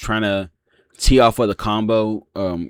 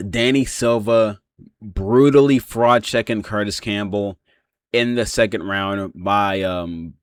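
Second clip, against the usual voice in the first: ordinary delivery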